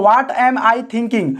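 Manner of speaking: very slow, word by word; dictating slowly to the class; slow dictation. Shown as a demonstration. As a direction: spell it out slowly for students. Dictating the English translation.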